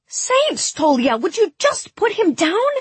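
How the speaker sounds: very high, urgent exclamation; exasperated outcry. A narrator's reading. Alina demands Tolia release Mal, high exasperated outcry. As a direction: cry out sharply to make him stop.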